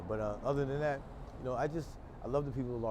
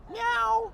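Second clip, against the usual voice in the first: playful meow; 10 dB louder than usual. Pretend. Man compliments a girl's cat outfit, then a high squeaky meow. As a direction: meow squeaked far higher, a loud cat mew.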